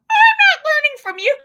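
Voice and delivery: high, loud exasperated voice; high, loud, half-joking exasperated mom; mock frazzled mom. Mock frazzled parent in class.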